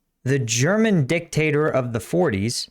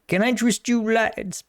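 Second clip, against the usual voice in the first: higher-pitched put-on British accent; mock British; 7 semitones higher than usual. Man popping out of bushes to offer drugs, mock British accent. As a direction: play a chipper British peddler. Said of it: "I don't know why he's British".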